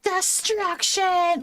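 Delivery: high, slow villain cry; drawn-out gleeful destroyer; unhinged villain. Pretend. Villain cry over demolished area.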